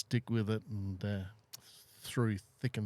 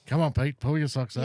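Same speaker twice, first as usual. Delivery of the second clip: mock chiding; 4 semitones higher, 8 dB louder than usual. Host chiding absent Pete.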